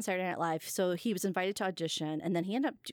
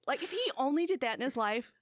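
ordinary talk, nothing marked

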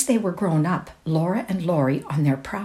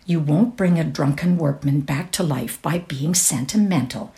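ordinary delivery

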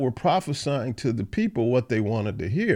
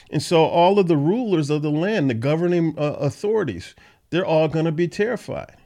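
usual speaking voice

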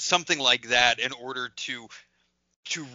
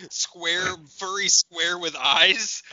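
usual speaking voice

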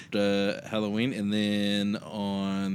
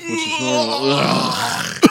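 mid-yawn; words stretched by a yawn; 4 dB louder, much slower than usual. Real, not acted. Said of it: "I just yawned in the microphone".

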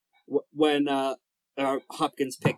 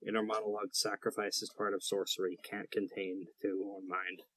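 low murmur; 7 semitones lower, 14 dB quieter than usual. Acted. NPC replies, low and soft.

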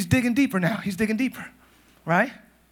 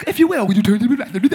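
affected English preacher's request; mock a formal English-accented pulpit invitation; put-on English preaching voice. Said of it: "you got an English accent".

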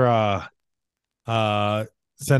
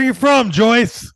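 calling out; 10 semitones higher, 5 dB louder, slower than usual. Pretend. Calling out, joining bit.

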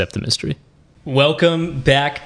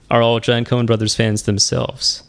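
ordinary talk

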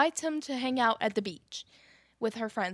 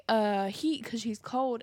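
usual speaking voice

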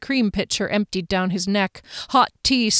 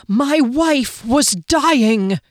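very slow, higher, louder outburst; terrifying intensity; 5 semitones higher, 5 dB louder, much slower than usual. A narrator's reading. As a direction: draw every word out with fierce intensity. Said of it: "Kian said with terrifying intensity".

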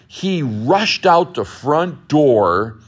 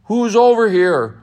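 higher, louder call across the burning barn; lifted voice; 9 semitones higher, 6 dB louder than usual. Acted. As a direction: shout out to find the voice. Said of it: "Lumberjack lifted his voice".

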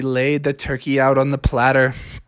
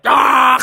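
high snarling "ah" into a rattle; rasping gator growl; alligator roar; 9 semitones higher, 3 dB louder than usual. Acted. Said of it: "the alligators were like".

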